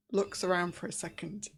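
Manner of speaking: ordinary talk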